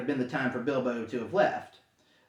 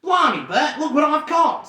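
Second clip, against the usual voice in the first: higher, louder surprised troll; excited troll; 10 semitones higher, 7 dB louder than usual. Acted. Troll William calling to Bert, excited and loud. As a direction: crow over the catch. Troll calls out his catch.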